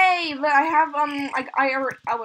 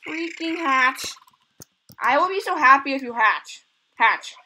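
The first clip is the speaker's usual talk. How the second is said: ordinary talk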